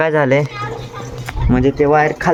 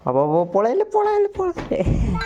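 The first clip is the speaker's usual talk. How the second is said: playful urging; 8 semitones higher, 5 dB quieter than usual. Real, not acted. Urging kids to run.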